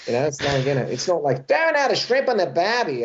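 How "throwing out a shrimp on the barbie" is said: high-pitched, nasal Australian caricature; nasally Aussie accent. Acted. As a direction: overdo a cliché nasal Aussie voice. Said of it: "Everybody's nasally".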